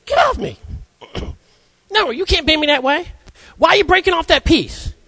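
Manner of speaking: very high, cartoonish talking clay; high squeaky clay yelling at the sculptor. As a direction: squeal like an angry lump of clay. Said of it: "the clay's like".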